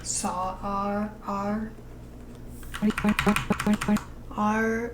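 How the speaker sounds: soft, drawn-out R with lips pursed; mouthing the R sound. Shown as a demonstration. Lip-sync coach demonstrating R, softly. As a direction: shape the R slowly for the animator. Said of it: "And for R's".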